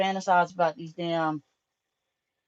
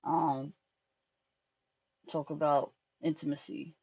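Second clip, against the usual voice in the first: ordinary talk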